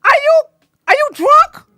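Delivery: high, loud disbelieving question; incredulous. Pretend. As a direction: sound stunned and mocking.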